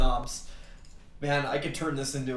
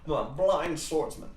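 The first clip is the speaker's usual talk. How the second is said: tag; 2 semitones higher than usual. Acted. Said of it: "a stream with Barack Obama playing Mortal Kombat".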